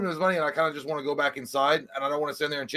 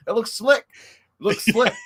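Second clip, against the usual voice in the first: higher, louder country twang; put-on country talk; 7 semitones higher, 4 dB louder, slower than usual. He fakes country talk to chat with the neighbor. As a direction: fake a country accent to fit in. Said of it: "I don't even talk like this".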